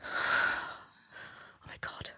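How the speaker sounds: slow whispered sigh of relief; relieved whisper. Retold or imitated. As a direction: let it out in a breathless whisper of relief. Relief as baby finally feeds.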